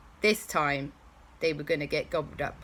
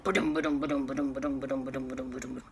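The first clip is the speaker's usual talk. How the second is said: rhythmic ba-dum fading softer; running footsteps; 2 semitones lower, 5 dB quieter, much faster than usual. Acted. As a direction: thump like galloping feet. Stegosaurus footsteps approaching.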